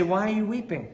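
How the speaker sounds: lightly voiced concerned husband; pleading husband. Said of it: "and say".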